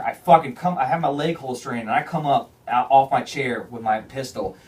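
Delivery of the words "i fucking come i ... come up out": ordinary talk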